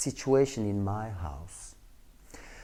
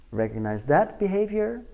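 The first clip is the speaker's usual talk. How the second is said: normal talking voice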